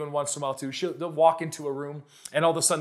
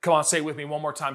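ordinary talk, nothing marked

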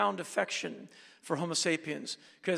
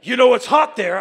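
tough interrogator; 8 semitones higher, 15 dB louder than usual. Retold or imitated. Angry customs officers interrogating.

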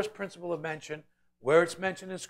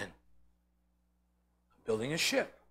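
Noah's calm reply; 3 semitones lower, 7 dB quieter, much slower than usual. Acted. Noah answers the mockers.

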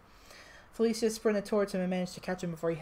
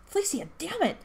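high angry shout; 11 semitones higher, 3 dB louder than usual. A narrator's reading. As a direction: yell it at her in frustration. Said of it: "Aurora shouted".

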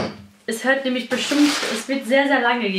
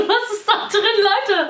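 excited; 8 semitones higher than usual. Real, not acted. Asks viewers while unpacking.

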